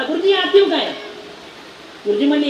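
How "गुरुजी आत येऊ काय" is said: high, loud timid schoolboy voice; a boy at the classroom door asking leave. Acted. Boy asks teacher to enter. (शाळकरी पोराचा उंच आवाज)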